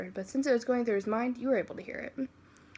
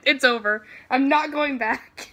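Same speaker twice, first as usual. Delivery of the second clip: mock defiance; 2 semitones higher, 7 dB louder, slower than usual. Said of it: "I'm like".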